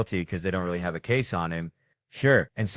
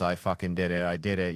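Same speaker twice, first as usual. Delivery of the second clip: usual speaking voice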